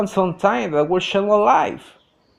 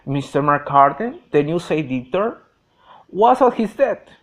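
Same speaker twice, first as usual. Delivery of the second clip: plain reading voice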